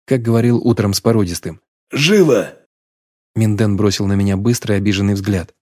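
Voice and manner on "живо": barked order, no arguments; sharp command. A narrator's reading. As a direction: snap the order out. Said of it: "я придавил приказом, не терпящим возражений".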